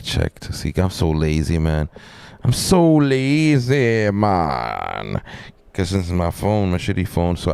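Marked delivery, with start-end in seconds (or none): 2.4-5.2 drawn-out whine. Pretend. Streamer moaning about being lazy, drawn-out falling whine. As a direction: stretch SO LAZY high, then sink on MAN. Drawn-out repeat of plain remark.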